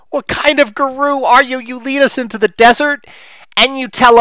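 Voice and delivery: high, loud mock-whiny listener voice; hammed-up pleading of frustrated listeners. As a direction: plead like exasperated followers of a stingy guru. Mocking listeners pleading with guru.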